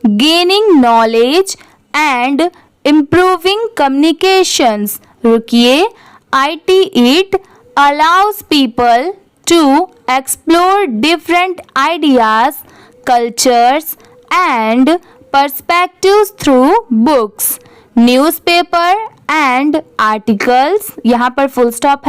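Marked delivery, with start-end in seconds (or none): 0.0-5.0 very slow, word by word with pauses; slow pausing read-aloud. Shown as a demonstration. Teacher reads paragraph aloud for students, very slow and measured. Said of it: "तो चलिए इसे ऐसे पढ़ेंगे". 6.3-20.9 very slow, word by word with pauses; slow pausing read-aloud. Shown as a demonstration. Teacher reads paragraph aloud for students, very slow and measured. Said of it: "तो चलिए इसे ऐसे पढ़ेंगे".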